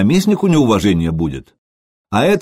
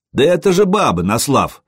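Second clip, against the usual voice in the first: high-pitched mock cry, falling on the name; jeering call; 6 semitones higher than usual. A narrator's reading. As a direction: call out a taunt, high then dropping. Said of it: "выкрикнул кто-то". (насмешливый выкрик)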